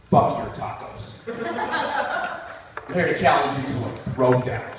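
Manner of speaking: low, fast tough challenge; low menacing challenge; tough Bobby. Retold or imitated.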